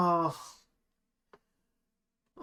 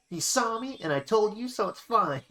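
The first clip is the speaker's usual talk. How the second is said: put-on higher child voice for Bonnie; 6 semitones higher than usual. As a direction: pitch up into a kid sibling's voice. Voicing Bonnie's lines in game.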